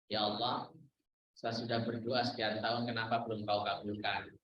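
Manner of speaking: low, soft, complaining plea to God; impatient prayer. Retold or imitated. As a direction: pray low and quiet, impatient for an answer. (doa mengeluh, suara rendah dan pelan)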